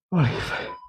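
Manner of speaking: gasping, voice gone; winded, barely voiced; breathless whisper. Real, not acted. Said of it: "let me catch my breath".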